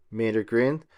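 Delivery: usual speaking voice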